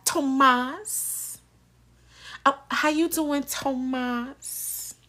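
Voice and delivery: Tomas softly drawn with a twang; twangy Tomas. Retold or imitated. Mocking Eva's twang on Tomas, soft. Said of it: "put a twang on Tomas".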